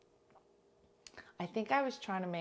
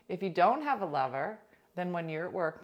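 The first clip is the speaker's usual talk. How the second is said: ordinary talk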